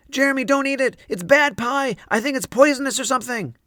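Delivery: desperate, loud, high warning; panicked. Acted. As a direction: cry out to stop your friend. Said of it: "I panicked".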